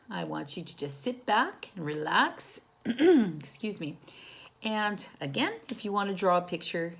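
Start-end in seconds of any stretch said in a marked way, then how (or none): none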